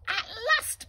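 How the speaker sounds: very high, loud cry of joy; high thrilled at last; delighted. Acted. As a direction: burst out in relief. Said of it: "Polly thought".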